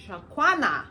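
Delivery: mock teacher triumphantly mispronouncing "Shakwana"; mangled name. Teacher confidently calling out the wrong name, loudly. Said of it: "see how she was gonna pronounce it".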